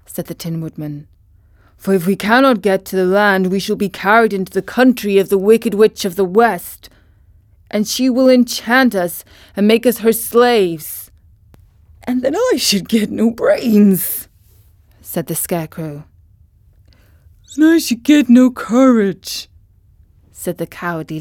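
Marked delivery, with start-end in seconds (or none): 1.8-11.1 louder, anxious tin man voice; worried Tin Woodman. Acted. Tin Woodman fears witch. 12.0-14.3 creaky scarecrow. Acted. Scarecrow laments. 17.5-19.5 higher, louder cowardly lion; timid lion voice. Acted.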